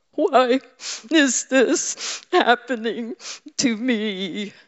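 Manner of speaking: high, drawn-out whining to God; whiner. Retold or imitated. Preacher whining to God in a mock whine. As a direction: whine it up high like a put-upon child. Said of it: "I can be a whiner with God".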